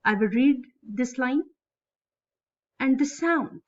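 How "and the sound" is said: light "And the", SOUND lifted then falling; stress on SOUND. Shown as a demonstration. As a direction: anapaest beat landing on SOUND. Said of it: "the main word is here on third number".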